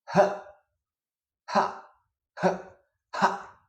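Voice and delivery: short, sharp puffs of breath; exhaled H. Shown as a demonstration. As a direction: exhale a quick "huh, ha". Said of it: "exhaling initially".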